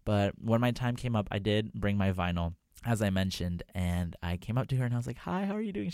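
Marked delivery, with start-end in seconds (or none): 5.2-5.9 chirpy higher-pitched hello, retold; nervous high greeting. Fan nervously greeting the singer, high and chirpy. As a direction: squeak out a nervous hi.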